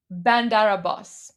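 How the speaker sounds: "Bandar Abbas" with the R rolling straight into the A, "Bandara-bbas"; run together as one word. Shown as a demonstration. Showing viewers to blend the two words, smooth and linked. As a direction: let "Bandar" flow into "Abbas" with no break. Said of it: "then you just say".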